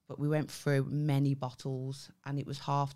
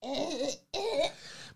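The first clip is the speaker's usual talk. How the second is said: helpless laughter; 11 semitones higher than usual. Real, not acted. Laughing at own story.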